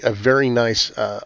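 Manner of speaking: ordinary talk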